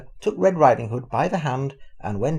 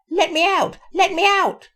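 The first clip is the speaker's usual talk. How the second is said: very high, loud granny yell; muffled granny shouting; 7 dB louder than usual. A narrator's reading. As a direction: bang and shout from the cupboard. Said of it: "she called from the inside".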